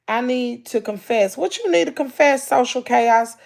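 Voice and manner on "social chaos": usual speaking voice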